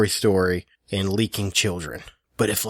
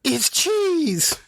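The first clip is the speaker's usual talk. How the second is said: much higher mock-broken wail; imitating wife. Husband mimicking wife's sad discovery, high mock heartbreak. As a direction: make it sound heartbroken and silly. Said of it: "And then you go".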